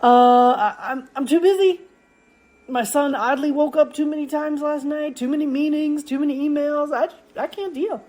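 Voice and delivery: high-pitched, acted-out excuse-making; stammering start, then a high running list of complaints; overwhelmed excuses. Acts out excuses for skipping practice.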